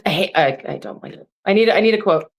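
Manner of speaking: usual speaking voice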